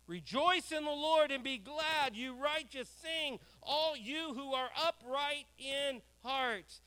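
loud, slow, ringing reading; rousing scripture reading. Acted. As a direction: proclaim the psalm slowly. Reading psalm aloud.

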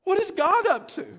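high, loud pleading question; anguished cry. Retold or imitated. Preacher voicing a desperate cry to God, pleading.

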